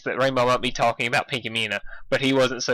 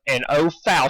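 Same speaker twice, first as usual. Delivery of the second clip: usual speaking voice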